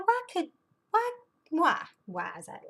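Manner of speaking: high-pitched exasperated why; exasperated, voice jumping up high. Retold or imitated. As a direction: plead why in mock exasperation, dropping at the end. Exasperated at being interrupted when live.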